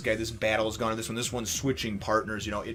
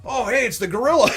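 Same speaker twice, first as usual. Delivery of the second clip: much higher, louder, laughing jab; mocking tease; 13 semitones higher, 9 dB louder than usual. [name] mocks Chopper.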